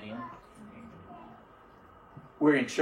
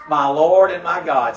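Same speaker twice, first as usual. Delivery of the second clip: louder awed exclamation; Thomas exclaiming; 2 semitones higher, 7 dB louder than usual. Retold or imitated. Thomas seeing the scars, awed louder exclamation. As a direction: blurt it out in amazement. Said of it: "Thomas sees the scars and he says".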